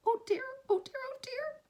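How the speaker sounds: high, fretful little character voice; worried Piglet. Acted. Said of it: "said Piglet to himself".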